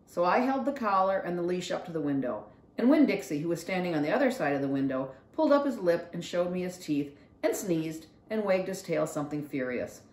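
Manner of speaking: plain reading voice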